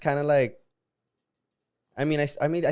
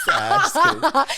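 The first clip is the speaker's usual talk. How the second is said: high squeal of laughter; real giggle while taking the joke back; laughing; 7 dB louder, slower than usual. Real, not acted. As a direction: laugh it out, much higher and louder. Teasing, then takes it back.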